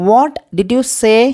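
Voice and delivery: very slow, each word laid out for learners; slow model phrase. Shown as a demonstration. Teacher to Tamil learners, slow clear model question. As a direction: drag the question out slowly like a teacher. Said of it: "teacher models English phrase twice".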